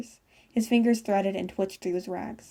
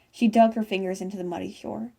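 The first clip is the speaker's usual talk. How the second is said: ordinary delivery, nothing marked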